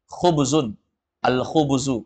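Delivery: "khubzun" with throaty KH and full ending; classical Arabic pronunciation. Shown as a demonstration. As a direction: sound out "khubzun, al-khubzu" with every case ending.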